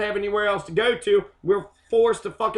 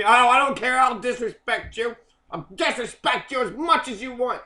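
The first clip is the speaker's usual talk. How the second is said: mock defiant woman; 4 semitones higher than usual. Voicing the woman's defiant reply.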